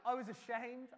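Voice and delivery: confessing through a laugh; laugh, then admit it sheepishly; embarrassed laughter at his own confession. Real, not acted.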